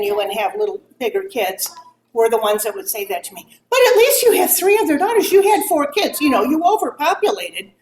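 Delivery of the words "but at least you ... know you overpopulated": high, fast, mocking singsong of a tactless mother; mocking imitation. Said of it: "is how I heard it".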